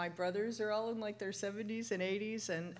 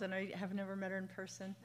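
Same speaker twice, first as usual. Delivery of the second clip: normal talking voice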